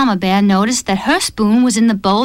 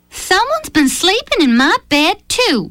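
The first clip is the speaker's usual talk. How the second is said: higher, plaintive mother bear voice; moaning Mama Bear; 9 semitones higher than usual. A narrator's reading. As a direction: moan it like a troubled mama bear. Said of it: "she moaned".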